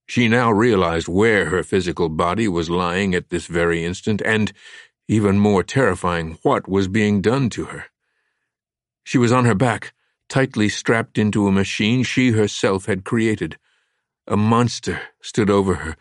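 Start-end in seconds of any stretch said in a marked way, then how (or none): none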